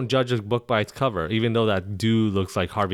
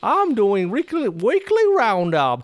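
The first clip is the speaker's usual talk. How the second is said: put-on much higher, slow, louder Western accent; a rodeo host in a big Western accent; 11 semitones higher, 4 dB louder, slower than usual. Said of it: "a Western accent?".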